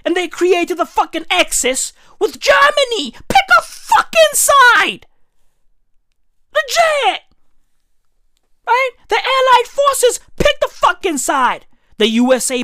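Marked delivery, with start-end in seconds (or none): 0.1-8.9 shouted rant. Real, not acted. Comedian yelling at Switzerland to pick a side. 9.1-11.7 shouted rant. Real, not acted. Comedian yelling at Switzerland to pick a side.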